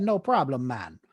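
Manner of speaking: lightly attempted Caribbean accent; a reluctant go at imitating a Jamaican lady. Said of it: "I hate doing the accent".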